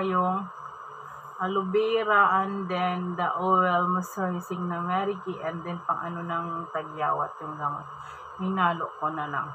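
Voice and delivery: speaking through fingers; talking while applying mask; hand partly over mouth. Real, not acted. Seen 1: Fingers repeatedly cover nose and mouth while spreading paste; clear 4.8-5.8.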